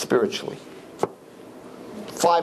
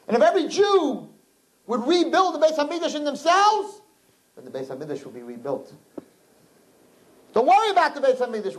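high, impassioned preacher's cry; fervent preaching. Acted. Rabbi preaching to the girls, high and impassioned. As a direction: lift the crowd to the rebuilding.

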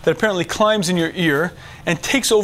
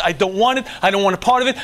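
ordinary talk, nothing marked

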